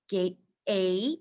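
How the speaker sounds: "Gate" followed by a held, rising "A"; sounded-out long A. Shown as a demonstration. Teacher identifying the sound, "Gate" stretched to long A. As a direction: pull the "ay" vowel out of "Gate". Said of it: "I'm saying A".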